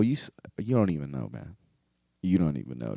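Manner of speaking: ordinary talk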